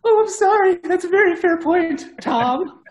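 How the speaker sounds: high, bright put-on woman's voice; girly voice. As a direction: squeak it up like a girl. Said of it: "You gotta use the chick voice".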